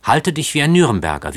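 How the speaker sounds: ordinary delivery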